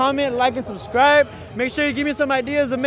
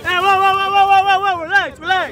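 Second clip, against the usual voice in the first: calling out; 6 semitones higher, 3 dB louder than usual. Real, not acted. Crowd rushing the host.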